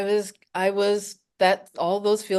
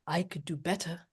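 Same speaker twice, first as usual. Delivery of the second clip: soft British inner voice, much quieter; British accent; 4 semitones lower, 13 dB quieter than usual. Retold or imitated. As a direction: murmur it in a British accent, as a voice in the head. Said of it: "that British accented voice pipes up".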